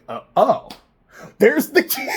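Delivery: sudden high loud "there's the key"; jolted from mantra into a surprised shout; startled discovery. Real, not acted. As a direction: spot the key mid-sentence, astonished. Suddenly spots lost key.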